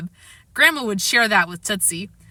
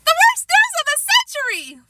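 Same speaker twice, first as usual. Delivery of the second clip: even higher mocking copy of her wail; imitating Sheila; 4 dB louder than usual. A narrator's reading. Fudge mocking Sheila's outburst, shrieking imitation. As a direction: ape her wail back at her. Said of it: "doing a perfect imitation of Sheila".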